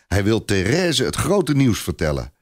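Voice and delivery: usual speaking voice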